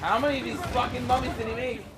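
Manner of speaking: loud exasperated shout; frustrated yell. Real, not acted. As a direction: yell it mid-fight, fed up. Fighting endless mummies, yelling.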